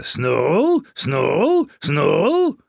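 high, rising bird cry; screeching raven. A narrator's reading. Agitated raven crying its master's name, rising screech. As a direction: screech it upward like a talking raven. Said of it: "schrie der Vogel immer wieder".

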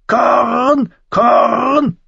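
very high, harsh cawing bird voice; croaking raven. A narrator's reading. The raven begs Mormont for corn, high croak. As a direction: caw the word like a raven.

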